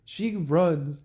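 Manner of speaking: ordinary talk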